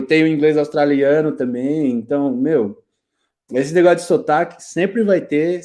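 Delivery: normal talking voice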